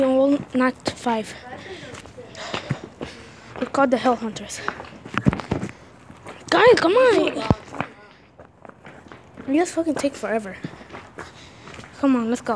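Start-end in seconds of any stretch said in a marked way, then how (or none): none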